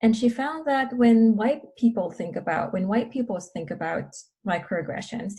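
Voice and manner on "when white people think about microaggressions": ordinary talk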